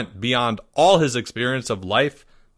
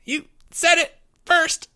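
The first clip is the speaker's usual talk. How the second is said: high, strained squeak; choked. A narrator's reading. Boy protesting from inside a headlock, high and choked. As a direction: squeeze it out of a caught throat. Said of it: "Timmy choked".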